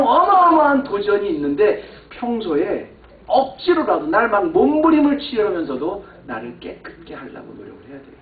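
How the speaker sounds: impassioned sermon climax; fiery preaching. Acted. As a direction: drive the point home to the congregation. Preaching at full force.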